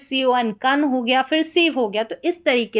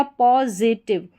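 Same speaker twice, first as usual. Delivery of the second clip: Positive drawn out at half pace, po, zi-tiv; slow, syllable by syllable; much slower than usual. Shown as a demonstration. Teacher answers own quiz for learners, slow and split. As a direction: split positive slowly into its parts.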